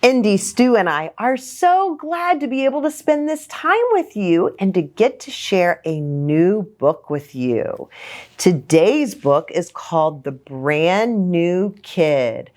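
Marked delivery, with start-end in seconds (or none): none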